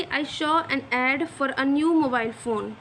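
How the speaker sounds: very slow, word-by-word dictation; example sentence read out. Shown as a demonstration. Teacher dictating an English example sentence very slowly. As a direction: read it out slowly so students can follow. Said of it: "example है कि".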